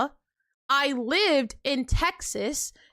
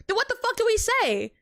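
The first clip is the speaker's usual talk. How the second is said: higher-pitched, incredulous comeback; exasperated retort; 9 semitones higher than usual. Real, not acted. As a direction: throw the question back at them in exasperation. Exasperated retort to a viewer's comment.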